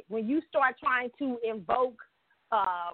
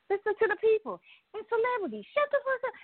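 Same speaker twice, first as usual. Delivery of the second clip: higher, fed-up snap at celebrities; exasperated outburst; 11 semitones higher, faster than usual. Real, not acted. Vlogger fed up with celebrity tweets, exasperated high snap. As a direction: let it burst out, sick of them.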